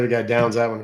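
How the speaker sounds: usual speaking voice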